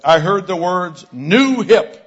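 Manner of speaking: high, loud, slow public-address call; intercom announcement. Retold or imitated. Young man calling out words over intercom, announcement voice. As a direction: call it out to a whole store. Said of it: "he gets the mic back".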